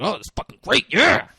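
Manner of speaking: mock hyped. Retelling getting hyped by song.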